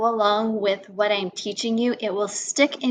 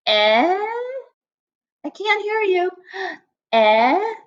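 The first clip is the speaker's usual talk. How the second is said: lightly voiced old woman straining to hear; a mock old lady cupping her ear; hard-of-hearing old lady; 9 semitones higher, slower than usual. Teacher playing an old player who can't hear, lightly voiced. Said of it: "she's, like, kinda old".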